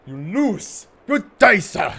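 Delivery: high, very loud theatrical outburst; hammed-up shout. Subject furiously dismissing the researcher, a hammed-up high shout. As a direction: bellow it in mock fury, pitch leaping up.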